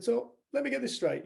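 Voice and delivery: high, loud disbelief; incredulous. Retold or imitated. Dave questioning the forgiving woman, high and incredulous. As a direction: let me get this straight, said in amazement.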